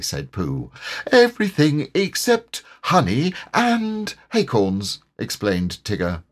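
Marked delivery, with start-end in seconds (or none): 1.1-5.0 high, emphatic character correction; high-pitched insistent tiger; self-assured tiger. A narrator's reading. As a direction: stretch out the added exception as a stubborn tiger. Tigger amends his claim emphatically.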